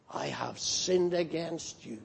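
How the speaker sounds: low, hushed and grave; quiet, reverent admission of sin; solemn confession. Acted. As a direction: confess it softly to God, in all seriousness. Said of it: "with solemnity and seriousness and with trembling".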